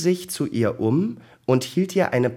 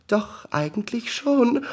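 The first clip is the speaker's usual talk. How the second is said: very high voice breaking into a sneeze; about to sneeze; 13 semitones higher, 3 dB louder than usual. A narrator's reading. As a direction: climb in pitch until the sneeze bursts out. Acted sneeze interrupts her answer.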